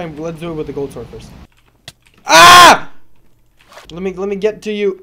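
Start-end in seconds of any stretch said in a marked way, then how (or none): 2.2-2.9 high loud cry; startled scream. Real, not acted. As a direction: shriek on impact.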